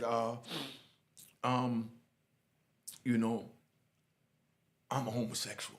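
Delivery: low voice with long halting pauses; hesitant confession. Retold or imitated. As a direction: imitate someone reluctantly getting it out. Acting out a reluctant confession.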